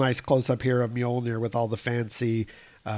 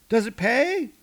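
higher, perky Darcy; a lightly voiced Darcy; Darcy's voice; 10 semitones higher, 4 dB louder than usual. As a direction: pitch it up for Darcy. Darcy asks Jane.

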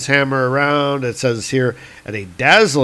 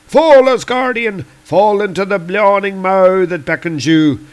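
high, loud theatrical curse; gloating Celtic god; 7 semitones higher, 5 dB louder than usual. Acted. Lear as Thor falls into the crevice, loud gloating. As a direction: cry it out over a falling foe.

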